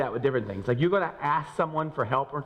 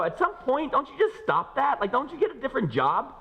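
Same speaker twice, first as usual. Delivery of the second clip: incredulous; 13 semitones higher than usual. Retold or imitated. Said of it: "you look at them and go".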